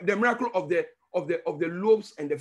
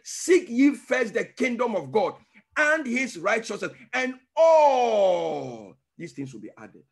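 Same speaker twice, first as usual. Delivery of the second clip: slow scripture quote; slower than usual. Retold or imitated. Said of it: "That's why he said".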